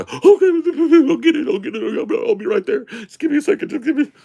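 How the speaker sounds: very high, frantic mock blubbering; whining and crying. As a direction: imitate a weepy waitress putting someone off. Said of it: "she's whining and crying to every customer".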